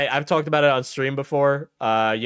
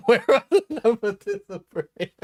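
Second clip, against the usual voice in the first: mocking laugh-through repeat; 9 semitones higher than usual. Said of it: "repeating chat's line".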